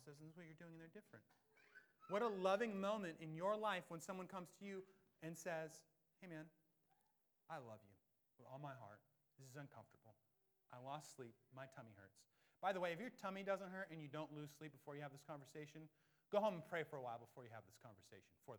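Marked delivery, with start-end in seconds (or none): 6.2-12.2 soft, tender and hesitant; tender, softer. Pretend. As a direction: gently confess your love and discomfort. Tender confrontation acted out.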